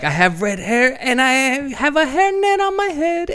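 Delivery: high, cartoonish sung line; goofy Sandler voice. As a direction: sing it high and silly. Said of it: "What would he say there".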